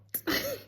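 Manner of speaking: a sudden self-mocking giggle; high laugh. Real, not acted. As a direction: laugh at yourself. Laughing at her own rambling.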